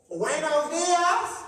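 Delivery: put-on high, slow, loud "Buenos dias" in a thick English accent; loud accented greeting. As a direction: call it out across the porch, drawn out and loud. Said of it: "why are they talking like that".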